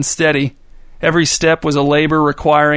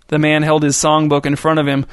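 usual speaking voice

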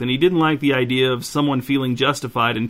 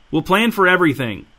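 higher, louder, curt and impatient; snappy; 6 semitones higher, 4 dB louder than usual. A narrator's reading. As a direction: snap it out, losing patience. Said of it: "Stefan's tone was getting snappy".

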